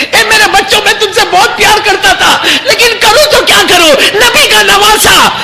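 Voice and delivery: high, loud wailing narration; lamenting sermon climax. Acted. Preacher wailing over Ali Asghar's thirst. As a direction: build the grief to a cry.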